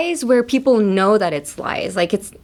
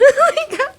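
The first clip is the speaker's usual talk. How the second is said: sudden high loud laugh; burst of laughter; 4 dB louder than usual. Real, not acted. Laughing at her own sulking story. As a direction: crack up.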